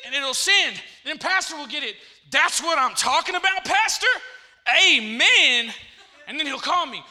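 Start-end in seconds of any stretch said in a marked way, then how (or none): 2.3-5.7 hammed-up shouted text reading; very loud and high, hugely pumped; excited. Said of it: "he's excited".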